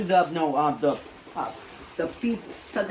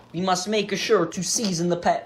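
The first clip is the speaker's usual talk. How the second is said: ordinary talk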